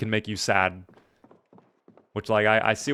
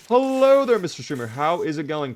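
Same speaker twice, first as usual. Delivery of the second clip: playful greeting; 5 semitones higher than usual. Pretend. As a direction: sing out the hello like a bright welcome call. Greeting a viewer in chat.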